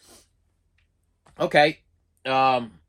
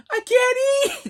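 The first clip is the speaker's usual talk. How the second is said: very high, slow drawn-out plea; exasperated whine; 4 dB louder, much slower than usual. Retold or imitated. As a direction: stretch it out in comic despair. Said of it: "I'm like".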